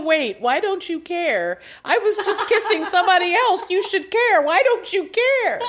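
playful Eden whining; mock indignant. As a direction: demand he care, half laughing. S2 voicing Eden's indignation.

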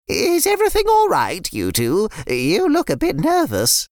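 put-on a fond nan greeting the kids; sweet old nan. Nan voiced.

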